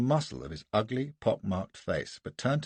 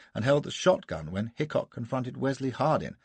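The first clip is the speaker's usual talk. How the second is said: usual speaking voice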